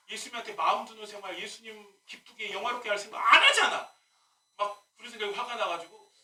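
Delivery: ordinary delivery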